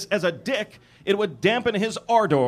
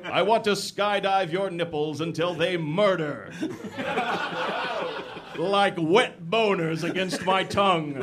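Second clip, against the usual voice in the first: lightly voiced mock seducer; a hint of hammed-up romance hero; male lover role. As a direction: read it as overwrought erotic dialogue, slowing at the end.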